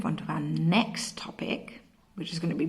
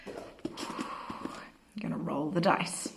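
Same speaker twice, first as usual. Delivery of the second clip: slow, secretive whisper; 2 semitones lower, 6 dB quieter, much slower than usual. Shown as a demonstration. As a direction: hush it out slowly to tease the kids. Same line, whispered slowly.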